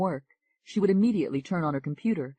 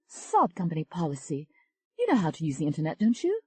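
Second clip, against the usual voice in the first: Tony's voice. Acted. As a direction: coax her friend, lightly pleading. Tony brushes off the rules.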